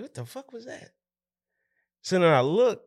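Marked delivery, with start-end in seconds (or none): 0.0-0.9 a sudden high, startled "what was that". Retold or imitated. Cook startled at work by a crash, blurting it out high. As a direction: jump at a crash behind you.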